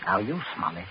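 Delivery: soft, cold, low instructions; calm and chilling, quietly in control; low menace. Acted. Prince quietly orders Smollett to dump the body, cold menace. Murderer calmly instructs captive.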